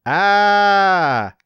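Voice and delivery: long, high, loud wolf-like howl; howling cry. Acted. Kid's voice echoing across the plain, howling. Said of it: "like a howling wolf".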